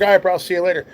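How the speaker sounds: retold parting call; called-out goodbye. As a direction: toss a loud goodbye across the house. Calls goodbye to his brother.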